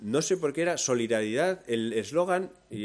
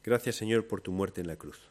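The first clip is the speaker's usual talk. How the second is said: prayer. Acted. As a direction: speak humbly to God. Leads a closing prayer.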